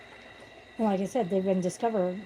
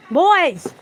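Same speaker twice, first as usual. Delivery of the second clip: calling the cats; 9 semitones higher, 11 dB louder than usual. Real, not acted. Scolding her fighting cats, a loud high yell. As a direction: bark the name across the room.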